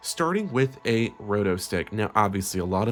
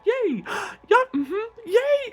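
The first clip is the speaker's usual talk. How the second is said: building from chirpy to much higher, louder squeals; forced psych-up; 4 dB louder, much slower than usual. Acted. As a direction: pump herself up until it tips into frantic cheer. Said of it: "I gotta psych myself up".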